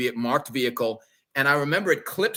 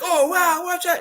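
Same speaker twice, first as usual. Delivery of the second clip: high mock surprise, much higher; mocking the rappers; 4 dB louder than usual. Rappers jumping up after drinks spill, high mock surprise. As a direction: squeal it like startled partygoers. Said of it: "they were like".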